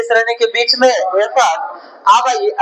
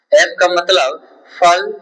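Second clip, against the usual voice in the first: each word spoken slowly and clearly, फल cut short with a rise; slow dictation with a rising फल; 4 semitones lower, much slower than usual. Shown as a demonstration. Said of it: "teacher dictates the answer".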